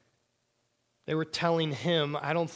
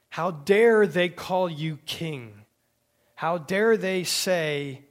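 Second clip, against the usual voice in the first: loud, slow, outraged rebuke; a Pharisee scolding the crowd in a mock outrage; indignant scolding; 4 semitones higher, 9 dB louder, slower than usual. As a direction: hammer out the outrage, drawing each word out. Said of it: "And yet some scolded".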